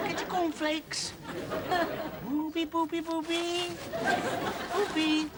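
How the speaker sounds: soft, high sing-out; coaxing dog call. Acted. Man luring a runaway dog with cornflakes, soft coaxing call. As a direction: call softly and high to a lost pet. Calling lost dog in the dark.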